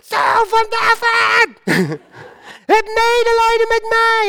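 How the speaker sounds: crank it up and call out long and high; volume turned up. Acted. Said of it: "ik doe die volumeknop nog iets open".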